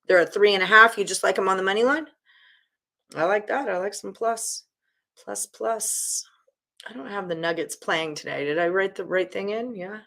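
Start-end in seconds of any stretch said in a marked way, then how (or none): 5.2-8.4 unvoiced muttered whisper. Real, not acted. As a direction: whisper under your breath. Whispering to himself.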